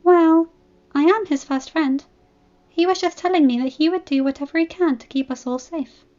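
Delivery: shy, bashful little voice; Fluttershy's voice. Acted.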